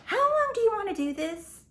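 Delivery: high, loud exasperated cry; exasperated outburst. Real, not acted. Vlogger scolding viewers, a high loud exasperated cry. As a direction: let it burst out high and loud in disbelief.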